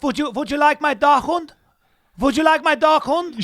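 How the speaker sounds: loud, high-pitched hammed-up German; mock German accent. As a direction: belt it out in a jokey German accent. Joking German dog-owner voice.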